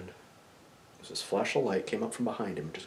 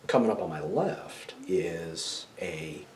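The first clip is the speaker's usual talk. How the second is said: ordinary delivery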